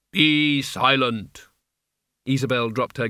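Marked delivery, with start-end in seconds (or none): 0.1-1.5 very slow, cold command; Death's voice. Acted. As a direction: spell out each word like doom. Death commands again, slowly.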